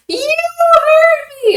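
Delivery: very high, loud, drawn-out squeal; imitated child's scream. Little girl accusing the woman, mock screaming. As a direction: mimic a little girl screeching an accusation slowly. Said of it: "she screamed, and she goes".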